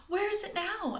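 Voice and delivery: put-on high, urgent child voice; anxious girl. Stella urgently asking her brother. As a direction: push for an answer. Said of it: "asked Stella".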